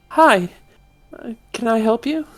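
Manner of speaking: tiny high voice; squeaky little shopkeeper; pixie. Acted.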